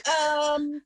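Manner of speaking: high, condescending boss voice; mock boss voice. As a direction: mimic the patronizing boss. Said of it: "And they're like".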